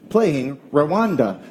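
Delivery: very slow, drawn-out assistant announcement. Retold or imitated. Siri answers the dad's request, slow assistant voice. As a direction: imitate a phone assistant confirming, slowly. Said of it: "it was like".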